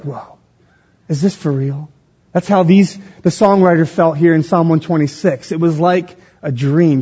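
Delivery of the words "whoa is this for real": soft, low, stunned wonder; hushed awe. Acted. Preacher voicing amazement at God's work, hushed awe. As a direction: drop right down, as if stunned.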